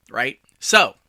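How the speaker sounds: usual speaking voice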